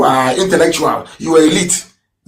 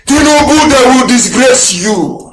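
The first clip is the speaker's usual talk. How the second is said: raised, slow warning to Tinubu; warning shout; 6 semitones higher, slower than usual. Retold or imitated. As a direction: call out the warning, slowly. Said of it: "I said it many, many times over".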